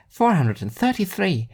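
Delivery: put-on high girl's voice; Alice's voice. A narrator's reading.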